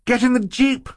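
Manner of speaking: high urgent exclamation; exclaiming. A narrator's reading. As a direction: cry out the order. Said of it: "Violet exclaimed".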